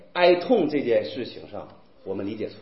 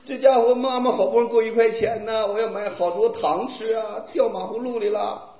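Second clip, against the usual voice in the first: mock crying; 3 semitones higher than usual. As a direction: wail over lost coins like a little kid. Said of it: "下水道那儿哭". (模仿小孩哭诉)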